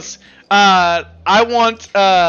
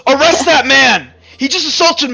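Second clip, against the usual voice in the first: high, loud cry for justice; outraged accusation; 6 semitones higher, 6 dB louder than usual. Acted. Shopkeeper demands an arrest from the guard, outraged.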